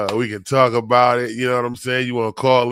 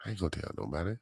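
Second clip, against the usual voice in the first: "I ain't gonna tell nobody" breathed much softer, like a secret; mock secretive whisper; 5 semitones lower, 16 dB quieter, much faster than usual. Shown as a demonstration. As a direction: lean in and whisper it like a confidant. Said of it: "you can let me know".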